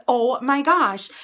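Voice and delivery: ordinary talk, nothing marked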